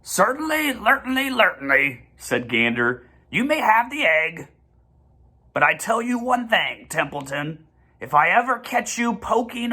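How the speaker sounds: high, threatening gander voice; stuttering gander. A narrator's reading. Gander threatens Templeton in a high, stammering voice. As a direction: gander warns the rat, repeating words.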